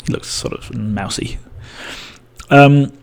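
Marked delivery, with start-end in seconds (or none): none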